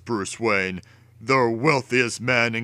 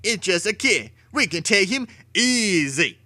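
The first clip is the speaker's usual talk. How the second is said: higher, sneering thug voice; a hint of a mocking gangster; tough crook; 9 semitones higher than usual. A narrator's reading. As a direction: scoff at the kid like a street tough.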